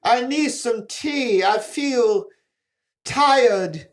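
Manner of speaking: high, slow hammy British voice; drawn out like a weary Englishman; a terrible British accent that sounds pained; bad British accent. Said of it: "Oh, crikey, Billy said".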